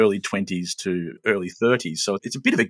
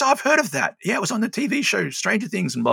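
put-on young woman voice; 12 semitones higher than usual.

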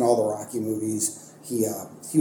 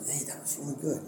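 hushed, gentle praise, much softer; soft encouraging teacher; 14 dB quieter than usual. Retold or imitated. Teacher quietly praising a student at his desk, hushed. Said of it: "put her hand on my shoulder and be like".

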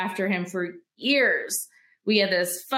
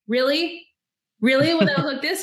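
incredulous sarcasm; 8 semitones higher, 5 dB louder than usual. Retold or imitated. Sarcastic retort to husband.

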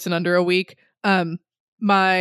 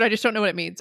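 normal talking voice

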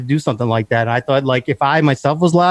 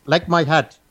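put-on a light comic Indian accent, held steady; 3 semitones higher, 3 dB quieter, slower than usual. [name] answering the interviewer in a playful Indian accent. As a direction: keep an easy Indian accent all through the answer. Maintain the accent the whole time.

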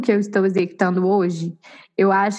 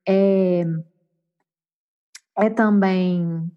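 trailing off; 2 semitones lower, much slower than usual. Real, not acted. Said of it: "esqueci o que eu ia falar".

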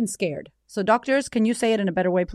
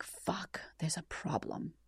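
a near-whispered, much softer curse of fear; hushed dread; 3 semitones lower, 16 dB quieter, much slower than usual. Retold or imitated. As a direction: say it under your breath as the bad news sinks in. Retold panic as scan goes bad.

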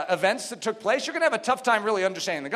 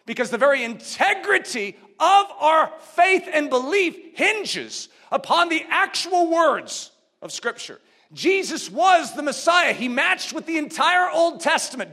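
higher and louder, slowing to drive it home; rising preaching; 6 semitones higher, 5 dB louder than usual. Acted. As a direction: preach it with conviction. Preaching at full force.

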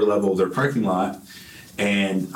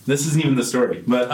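ordinary talk, nothing marked

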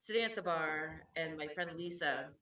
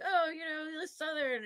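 much higher, playful ribbing of the Georgia crowd; teasing high mock voice; 10 semitones higher, 4 dB louder than usual. Friend teasing the Southern patrons in a high mock voice. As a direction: pitch it way up to tease them. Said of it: "He was like".

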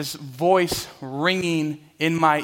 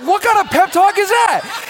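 much higher, louder disbelieving outburst; incredulous shout; 9 dB louder than usual. Retold or imitated. Player reacting to a friend's useless pep talk, incredulous shout. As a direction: blurt it out, baffled and loud. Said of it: "I was like".

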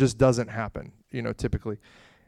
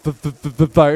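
stuck on the f, then a louder fire; repeating the overwhelmed stammer; quick stammer ending loud; 2 semitones higher, 10 dB louder than usual. Retold or imitated. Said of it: "That's all I could get out".

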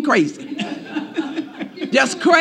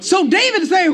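ordinary talk, nothing marked

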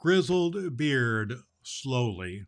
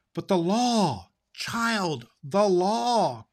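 higher, louder stern rebuke; stern cry; 6 semitones higher, 4 dB louder, much slower than usual. Acted. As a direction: scold her firmly. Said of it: "cried Ak sternly".

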